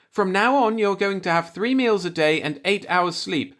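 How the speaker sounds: Mrs. Burrows firm. A narrator's reading. Landlady telling Jack the rules, motherly firm. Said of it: "Missus Burrows told him".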